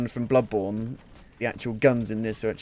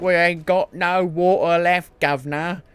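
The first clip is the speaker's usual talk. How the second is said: mock Cockney; 5 semitones higher, 5 dB louder than usual. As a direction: echo the line like a London cabbie. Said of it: "Are you sure you're French?".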